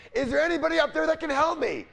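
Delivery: high, loud desperate call upward; yelling up for help. Acted. Man hanging on to the ledge, yelling up for help. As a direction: yell to whoever might be up there. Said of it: "finally he yells up".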